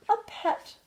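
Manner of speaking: high, delighted goldfish voice; excited high-pitched cartoon fish; glubbing goldfish. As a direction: exclaim it high, as a thrilled little fish. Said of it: "Gilbert glubbed".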